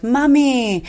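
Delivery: put-on high-pitched little boy calling Mommy; lightly sung-out child voice; little son. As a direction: imitate a three-year-old calling for mum. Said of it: "my son was like".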